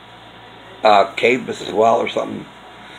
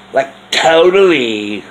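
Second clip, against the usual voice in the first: put-on Valley Girl voice; 2 semitones higher, 5 dB louder, slower than usual. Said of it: "Valley Girl talk".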